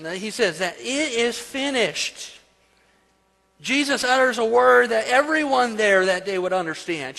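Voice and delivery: high, loud preacher's proclamation; preaching. Acted. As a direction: preach it out with rising force. Preaches at full force.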